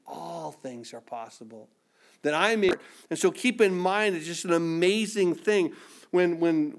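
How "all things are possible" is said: very soft, low assurance; hushed reassurance. Acted. Preacher voicing God's answer to the weary, hushed. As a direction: drop right down to a gentle hush.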